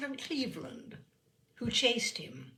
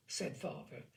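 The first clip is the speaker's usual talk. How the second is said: ordinary delivery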